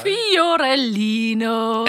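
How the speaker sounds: drawn-out mock Italian Fiorellino; Italian word echoed. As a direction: stretch out Fiorellino with Italian flair. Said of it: "das heißt auf Italienisch". (Fiorellino gedehnt mit italienischem Klang)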